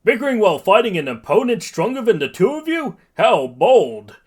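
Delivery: booming, high mock-astonished hero; All Might. A narrator's reading. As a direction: taunt them in a big loud voice. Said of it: "All Might suddenly appears".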